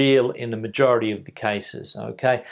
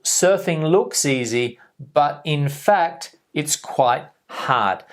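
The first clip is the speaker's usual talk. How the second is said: slow example reading; slower than usual. Shown as a demonstration.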